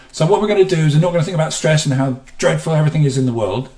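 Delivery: rushed, high and loud; fast talk. Shown as a demonstration. Teacher demonstrating stressful fast speech, high and loud. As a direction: gabble it out hurried. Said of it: "as I talk in a fast way".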